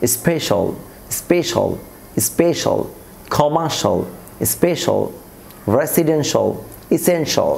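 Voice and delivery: "স্পেশাল" as "ispe-shol"; corrected "shol" ending. Shown as a demonstration. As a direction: end "রেসিডেন্সিয়াল" and "এসেনশিয়াল" in one-syllable "shol". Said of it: "শিয়ালকে আমরা শলে পরিণত করব".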